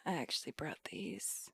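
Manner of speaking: breathy whispered socialite take on the line; whispered Paris Hilton try. Shown as a demonstration. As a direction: hush it like a bored heiress. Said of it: "I feel like I'm not hitting it".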